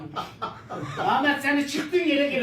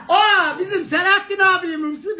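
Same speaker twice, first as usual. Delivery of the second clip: surprised; 6 semitones higher, 8 dB louder than usual. Retold or imitated. Retold surprised recognition.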